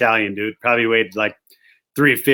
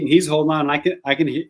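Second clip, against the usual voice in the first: ordinary talk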